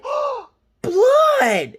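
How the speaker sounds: high, loud imitated gasp-and-cry; mock shocked shriek. Girl sees she is bleeding, mock shriek. Retelling daughter seeing her blood.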